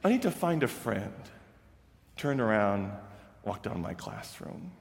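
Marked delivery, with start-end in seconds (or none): 0.0-1.1 higher, earnest boy's voice imitated; pleading student. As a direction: plead like Travis. Teacher voices Travis's final plea.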